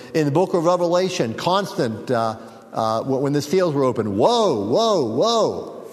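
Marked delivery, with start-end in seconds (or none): 4.2-5.9 oracle of doom. Acted. Preacher quoting Revelation's seals, a doom cry. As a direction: ring out each woe like a bell of doom.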